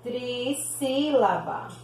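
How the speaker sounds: trissílaba drawn out slowly, each syllable clear. Shown as a demonstration. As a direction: spell out trissílaba slowly for the class. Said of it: "ela é chamada de".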